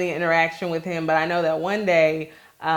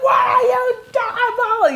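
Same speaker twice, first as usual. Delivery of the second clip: slow, much higher shout at the cop; drawn-out shout; 5 dB louder, much slower than usual. Retold or imitated. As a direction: stretch out the words in a desperate shout. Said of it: "I ran towards the cop and I was like".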